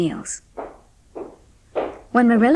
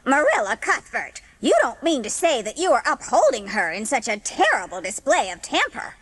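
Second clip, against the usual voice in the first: indignant, offended gossip neighbour; lightly voiced scandalized old neighbour; Mrs. Lynde; 3 semitones higher than usual. Narrator voicing Mrs. Lynde protesting to Marilla, mildly indignant.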